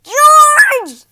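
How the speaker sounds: huge, high furious yell of the name. A narrator's reading. Shop owner yells at George for the ruined order, furious shout. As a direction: bellow George across the shop. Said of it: "Mister Herb yelled".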